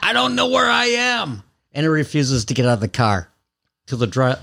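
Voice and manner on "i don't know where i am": high-pitched mock screaming kid; imitated child's scream, pitched way up. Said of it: "And then he starts screaming".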